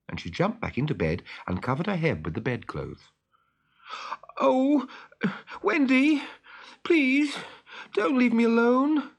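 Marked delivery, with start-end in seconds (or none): 4.4-9.1 put-on high, louder, begging boy's voice; pleading. A narrator's reading. Peter begging Wendy not to leave him, high loud pleading. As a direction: beg her not to go. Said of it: "pleaded Peter".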